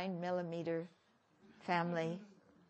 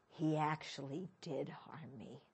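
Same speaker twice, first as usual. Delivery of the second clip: drops to a near whisper; hushed; 7 semitones lower, 5 dB quieter than usual. Real, not acted. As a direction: says it under the breath. Private grievance, lowered voice.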